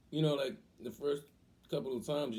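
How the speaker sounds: normal talking voice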